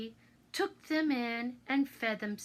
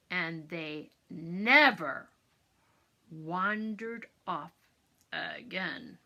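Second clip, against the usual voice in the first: gentle moral; 6 semitones lower, slower than usual. A narrator's reading. Slow moral at story end.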